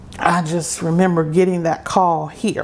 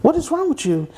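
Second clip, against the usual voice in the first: worried godmother; 10 semitones higher than usual. Retold or imitated. Godmother repeats her question, pressing.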